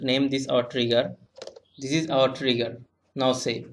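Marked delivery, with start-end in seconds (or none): none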